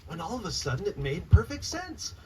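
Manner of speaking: lively rhyming storybook read-aloud; reading to children. Acted. Author reading her picture book to children, lively read-aloud. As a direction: bounce the rhymes for a room of kids.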